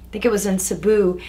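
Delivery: ordinary talk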